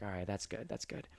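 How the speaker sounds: softly relieved, almost to self; under breath. Retold or imitated. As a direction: mutter it quietly in relief.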